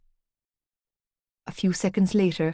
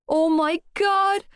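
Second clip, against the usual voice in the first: high, slow cry of panic; rising panic, drawn out; panicked gasp; 9 semitones higher, slower than usual. Acted. Duvet moving, panic.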